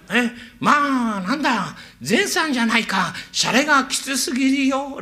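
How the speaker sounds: mild annoyed courtesan. Retold or imitated. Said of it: "花魁が怒りますよ".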